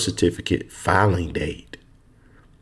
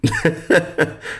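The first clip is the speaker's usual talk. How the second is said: laugh; 9 semitones higher than usual. Real, not acted. Laughs after his point.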